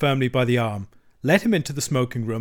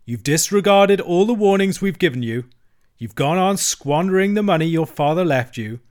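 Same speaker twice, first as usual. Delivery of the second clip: higher, louder stern scolding; severe; 5 semitones higher, 5 dB louder than usual. A narrator's reading. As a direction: lay out the charges severely. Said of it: "the Badger explained severely".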